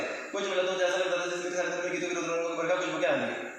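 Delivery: very fast recitation; hurried poem lines. Shown as a demonstration. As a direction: rush every word together. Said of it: "sir आपने बहुत fast बोला".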